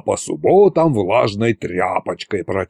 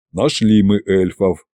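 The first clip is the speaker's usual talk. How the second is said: ordinary delivery, nothing marked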